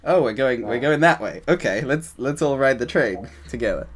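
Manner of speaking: eager, rallying the group along; acted excitement. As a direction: play someone catching the group's excitement. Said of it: "it comes across through the voice as well".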